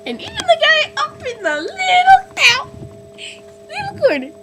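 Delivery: very high, loud comic squeal; squealed call-out. Pretend. Vlogger singling out hidden viewers, squealing mock call-out. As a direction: squeal it with silly, hammed-up glee.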